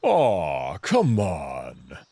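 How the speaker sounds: drawn-out aw, then a falling come on; whiny protest. Acted. Amicus begs his friend to spar, pleading and whining. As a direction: sulk and plead at once.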